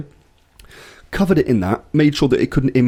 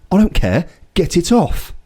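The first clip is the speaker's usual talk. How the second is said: dismissive ref; 7 semitones higher than usual. Retold or imitated. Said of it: "Ref goes".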